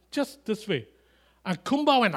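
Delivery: ordinary talk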